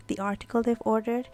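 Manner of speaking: ordinary delivery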